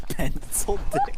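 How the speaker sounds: barely holding it together; cracking up on the punchline; laughing through it. Real, not acted. Reader cracking up mid reading.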